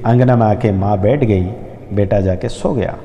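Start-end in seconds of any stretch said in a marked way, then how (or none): none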